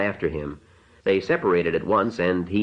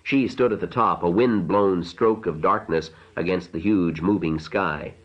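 usual speaking voice

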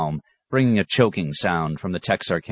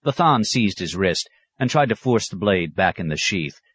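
ordinary delivery

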